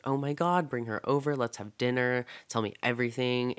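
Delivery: normal talking voice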